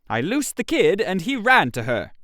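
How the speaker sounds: ordinary delivery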